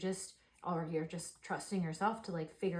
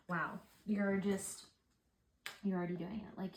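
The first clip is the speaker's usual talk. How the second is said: usual speaking voice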